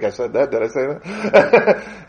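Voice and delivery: slow, amused self-questioning; laughing. Real, not acted. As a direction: laugh at your own slip. Speaker laughs at own slip.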